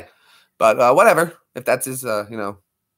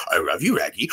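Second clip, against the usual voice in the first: Scooby-Doo voice; 8 semitones lower, 4 dB quieter than usual. Acted. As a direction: lower dog voice. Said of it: "too easy for Scooby-Doo".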